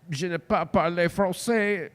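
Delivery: hesitant put-on French; halting French. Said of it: "and I go, Uh".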